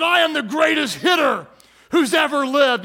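high, loud shout of a cocky little slugger; boastful kid. Acted. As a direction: proclaim it like a kid hyping himself up. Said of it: "it's like the kid who".

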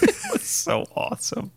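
breathy high sarcastic; sarcastic squeal. Pretend.

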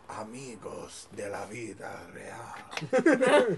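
slow whisper with a Spanish accent. Shown as a demonstration. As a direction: breathe the line out slowly. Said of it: "everyone say that line".

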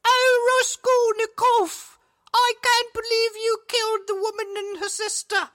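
a man hamming up a woman's voice in a very high falsetto; mock female character. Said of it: "when he read the female characters".

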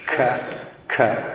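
K struck hard at the palate; sharp k. Shown as a demonstration. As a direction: knock out the K. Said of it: "knock on that door with the letter K".

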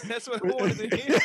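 high, helpless laughing agreement; real cracking up mid-sentence. Real, not acted. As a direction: speak through a burst of laughter. Laughs at host's remark.

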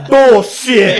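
loud, high, laughing cry of disbelief; imitated disbelief. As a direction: burst out as if you can't believe your eyes. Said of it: "like, in disbelief".

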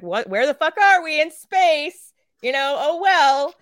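high, loud dopey grunt voice; clueless marines. Retold or imitated. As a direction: play the confused soldier waking up. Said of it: "they're just like".

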